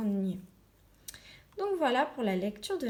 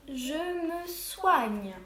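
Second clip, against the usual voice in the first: je me soigne read very slowly, the first word lifted; 5 semitones higher, much slower than usual. Shown as a demonstration. Teacher reading the last phrase slowly during correction. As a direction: stretch the phrase out for pupils. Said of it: "et le dernier".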